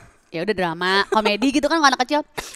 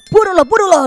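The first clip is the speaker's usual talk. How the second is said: higher, louder mock hurrying of the queue; impatient queue; 9 semitones higher, 11 dB louder than usual. Imagined queue urging the tourist to hurry. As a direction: voice the people in line pushing. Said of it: "orang ngantri kayak takutnya".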